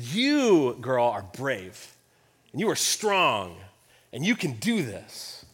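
put-on soft, slow and low, like reassuring a child; tender encouragement. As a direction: quietly build her up. Dad encouraging daughter tenderly.

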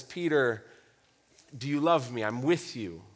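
low, soft, caring reassurance; Jesus, tender. Retold or imitated. Loving rabbi invites Peter back, tender and low. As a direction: ask gently, like a loving father. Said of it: "with all the tenderness, with all the care".

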